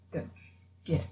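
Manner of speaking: ordinary talk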